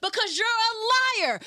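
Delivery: normal talking voice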